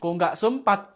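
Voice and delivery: ordinary talk, nothing marked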